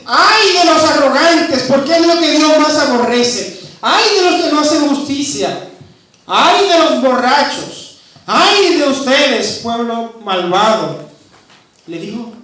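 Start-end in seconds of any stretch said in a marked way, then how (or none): 0.0-11.1 loud, slow, hammering woes; prophet's woes. Retold or imitated. Isaiah pronouncing woes on the people, loud and slow. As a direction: pound out each Ay like a judgment. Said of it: "Le dice".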